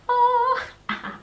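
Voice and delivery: high strained cry; loud high stretching yelp; stretching groan. Real, not acted. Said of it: "trying to talk and stretch".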